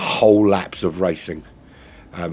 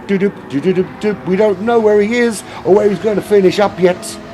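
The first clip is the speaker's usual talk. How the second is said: excited, higher-pitched sim racing commentary; live race play-by-play; 10 semitones higher, 5 dB louder than usual. Real, not acted. Streamer calling the lagging racer off the line, excited race commentary. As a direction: call the race start with rising urgency.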